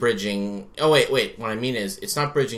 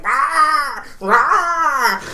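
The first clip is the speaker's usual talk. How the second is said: loud, high demonic "rah" yell; mock possessed roar; 7 dB louder than usual. Contortionist pretending he's possessed on the con floor, mock roar. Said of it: "pretending he's possessed and going".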